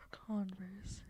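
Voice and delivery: whispering. Real, not acted. Puzzling over the clue word.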